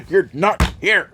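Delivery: loud, high, drawn-out mock scolding; mock shout. Host razzing co-host Dakota for missing the show, mock shout.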